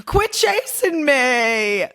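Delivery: high, drawn-out reality star whine; slow, high, put-upon plea; Danielle Staub. Retold or imitated. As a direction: stretch it out like a fed-up reality star. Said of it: "Danielle Staub ... when she goes".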